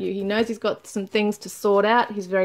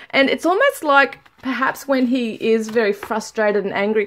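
normal talking voice

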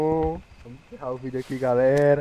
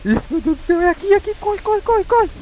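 very high, loud frantic panic; scream-panic at the monster; panicking gamer; 13 semitones higher, 4 dB louder than usual. Real, not acted.

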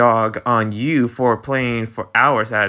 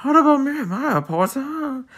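pitched-up sulky gadget begging for attention; Switch Lite's voice; 11 semitones higher than usual. Acted. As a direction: plead like a lonely toy left behind. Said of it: "That's my voice for it".

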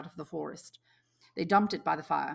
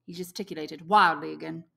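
ordinary delivery, nothing marked

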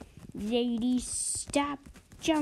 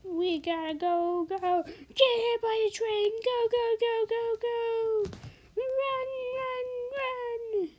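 high urgent calls to run; being chased; 13 semitones higher than usual. Acted. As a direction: yell to flee the train. Fleeing from train in game.

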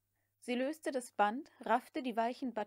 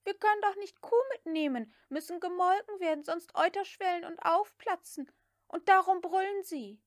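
high, eager maid's voice; young maid Prissy; 8 semitones higher, 6 dB louder than usual. A narrator's reading. Prissy explains the cow to Scarlett in a high voice. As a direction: play the chattering servant girl.

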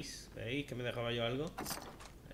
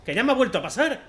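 much higher and louder complaint; frustrated shouting; 10 semitones higher, 12 dB louder, faster than usual. Real, not acted. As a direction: yell at being stuck again. Atascado en la estantería. (queja a gritos, atascado otra vez)

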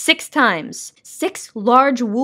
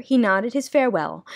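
plain reading voice

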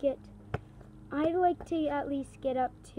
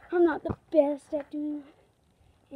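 ordinary talk, nothing marked

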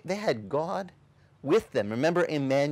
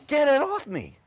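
mock panic; 11 semitones higher than usual. Donkey voiced in a story.

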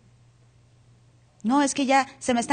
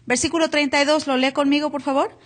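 ordinary talk, nothing marked

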